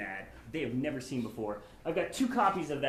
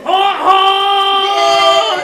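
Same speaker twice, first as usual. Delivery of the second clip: loud mock honking; 22 dB louder than usual. Trucker honking at upskirt.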